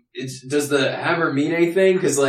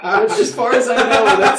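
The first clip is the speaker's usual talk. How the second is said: man doing a woman's voice; 9 semitones higher, 5 dB louder than usual. Pretend. Still in girl voice.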